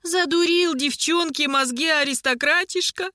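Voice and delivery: put-on high, whining old-woman lament; village-granny lament. A narrator's reading. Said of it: "запричитал мужчина, как деревенская старушка".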